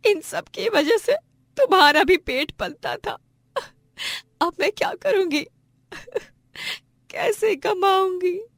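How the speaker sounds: high-pitched tearful wail between sobs; sobbing lament. Acted. Wife mourns her lost livelihood, sobbing. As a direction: weep through the words.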